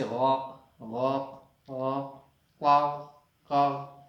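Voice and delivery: cawing غاق and قاق, loud; crow caw. Shown as a demonstration. Teacher imitating a crow for the class, cawing. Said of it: "یہ آواز ہے نا،".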